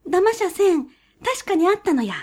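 high, sly weasel voice. A narrator's reading.

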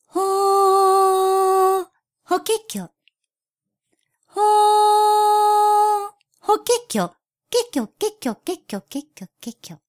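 high bird trill dying away; warbler song fading. Acted. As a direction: let the birdsong fade into the distance. Warbler sings, trills fade away.

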